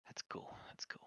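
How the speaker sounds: resigned whisper to self; muttered whisper, giving up. Real, not acted. Resigned after the save mishap.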